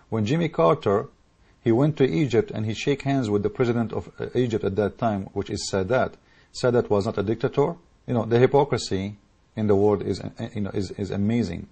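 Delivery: normal talking voice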